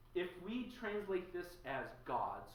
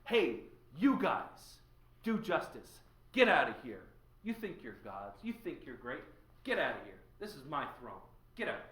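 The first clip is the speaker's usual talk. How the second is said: loud, furious God throwing them out; angry eviction; 9 dB louder than usual. Acted. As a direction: bark them out of the room like an intruder who owns the place. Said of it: "he kicks down the door and bursts and says".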